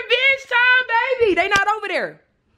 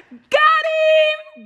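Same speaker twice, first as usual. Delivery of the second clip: triumphant shout; 11 semitones higher than usual. Real, not acted. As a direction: yell it out, gotcha. Prank reveal triumph.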